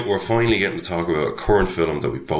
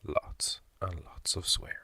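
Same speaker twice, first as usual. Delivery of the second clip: unvoiced whispered warning, slow; spooky whisper; 3 semitones lower, 11 dB quieter, slower than usual. Host whispers the swearing warning to listeners, hushed and spooky. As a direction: breathe the warning like a ghost story.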